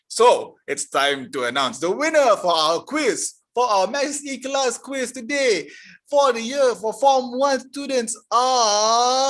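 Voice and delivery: big, loud game-show announcer delivery. Pretend. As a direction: draw it out loudly, building suspense for the winner. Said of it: "let me give my announcer voice".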